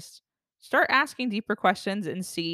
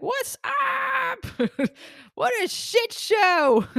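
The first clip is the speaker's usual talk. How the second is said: much higher, slow silly voice with laughs; goofy put-on voice; 3 dB louder, much slower than usual. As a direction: ham it up like a cartoon buddy. Greeting her people, joking.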